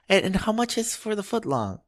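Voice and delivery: high mock old-lady questioning; cute old lady. Old lady pestering the clerk about sizes, cute high voice. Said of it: "this is how cute she was".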